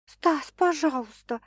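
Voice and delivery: slow, near-whimpering plea; plead, almost whining. Acted. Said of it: "почти захныкала Саша".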